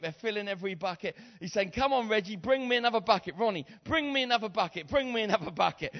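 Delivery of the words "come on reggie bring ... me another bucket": excited calling out. Acted. Mother calling for more buckets.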